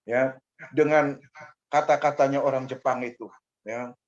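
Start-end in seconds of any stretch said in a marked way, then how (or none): none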